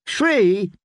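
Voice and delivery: put-on high, quick childish question; a greedy little boy haggling; eager child. Boy bargaining for more tales.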